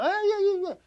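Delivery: high mock whimper of self-pity. Preacher mocks a whining discouraged believer, high hammed-up whimper. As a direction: whine it like a hurt child, hammed-up. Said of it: "ahí está lloriqueando".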